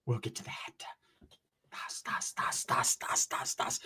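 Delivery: breathy whispered chant of das; spooky whisper. Pretend. Comic teasing the audience, eerie whisper. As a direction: whisper it like a horror soundtrack.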